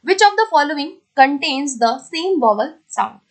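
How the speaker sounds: very slow, word-by-word reading of the question; deliberate dictation pace. Shown as a demonstration. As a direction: read it out slowly for students to follow. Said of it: "eighteen question देखते हैं".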